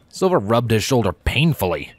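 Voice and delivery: ordinary delivery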